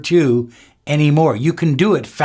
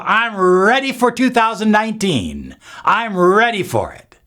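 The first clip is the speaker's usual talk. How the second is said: high, bright declaration; excited affirmation; 7 semitones higher than usual. Shown as a demonstration. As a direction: proclaim it like a promise. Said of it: "Write it down below".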